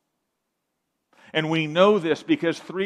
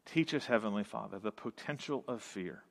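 lower, softer prayer voice; 5 semitones lower, 8 dB quieter, faster than usual. Acted. Pastor leading the church in closing prayer: low, soft, earnest. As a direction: pray it quietly to the Father.

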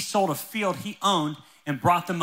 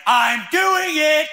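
high, loud, stretched-out battle cry; mock-heroic shout of resolve; psyched-up resolve; 10 semitones higher, 5 dB louder, much slower than usual. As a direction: hype yourself up, dragging the words out. Acting his excited resolve in line.

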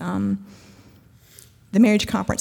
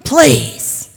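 high, loud desperate prayer, ending slow; an exhausted mother begging God through the stall door; frazzled mom praying; 7 semitones higher, 10 dB louder, slower than usual. Retold or imitated. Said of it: "she heard this female voice go".

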